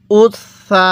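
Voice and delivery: উস সা, long held s across both syllables; doubled consonant. Shown as a demonstration. Teacher leading learners to read along, doubled s held long. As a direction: hold the s from উস into সা. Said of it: "আমার সাথে পড়ুন".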